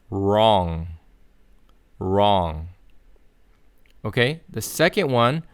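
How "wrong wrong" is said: wrong with a curled English R; English word. Shown as a demonstration. Teacher giving the English word wrong as a model. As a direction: say wrong with the tongue never touching. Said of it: "sound like the English word".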